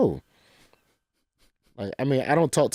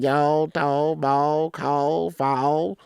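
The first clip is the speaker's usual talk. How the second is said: a lightly done Southern drawl, somewhat higher; 5 semitones higher, much slower than usual. Retold or imitated. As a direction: stretch the words out in a playful Southern drawl. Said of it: "I was raised on Southern drawls".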